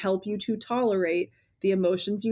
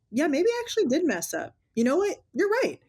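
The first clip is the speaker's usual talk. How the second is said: higher, rising admission; mock humble parent; 9 semitones higher than usual. Therapist voicing a parent admitting fault, higher. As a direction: act out a parent owning a mistake. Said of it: "being called out for".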